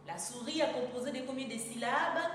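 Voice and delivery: ordinary talk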